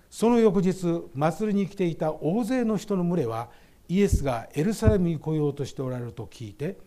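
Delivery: loud, ringing Bible reading to the congregation; scripture reading. Acted. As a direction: proclaim the verses out loud. Reads scripture aloud in sermon. (会衆に向けて聖書を朗々と大きな声で読む)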